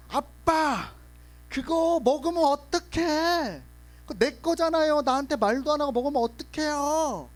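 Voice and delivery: put-on high, indignant child voice scolding dad; indignant little kid. Kid scolding dad for eating her snack, high indignant child voice. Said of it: "걔는 이게 원래 화나면".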